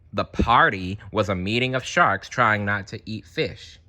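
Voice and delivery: usual speaking voice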